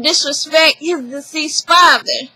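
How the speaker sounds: normal talking voice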